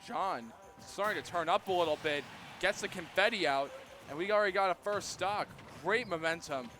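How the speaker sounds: energized esports shoutcast; excited commentary. Real, not acted. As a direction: build up the hype as the lead is taken. Hyped reaction to John's first stock.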